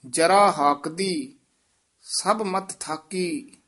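ਜਰਾ ਹਾਕ ਦੀ said as one joined phrase, then a pause; phrased recitation. Shown as a demonstration. Teacher showing the student how to phrase the line. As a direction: keep ਹਾਕ ਦੀ together without a break. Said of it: "ਹਾਕ ਦੀ ਪਾਠ ਇਕੱਠਾ ਹੀ ਕਰਨਾ ਹੈ".

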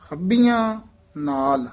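ਹੱਬੀਆਂ said tight, with a doubled ਬ; ਹੱਬੀਆਂ with a held, firm ਬ; firm pronunciation. Shown as a demonstration. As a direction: press hard on ਹੱਬੀਆਂ. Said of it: "ਹੱਬੀਆਂ ਕੱਸ ਕੇ ਬੋਲਣਾ ਹੈ".

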